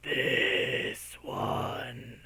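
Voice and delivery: a hint of a ghostly skeleton, hushed; unearthly voice. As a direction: breathe it out like a dead thing. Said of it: "in an unearthly voice".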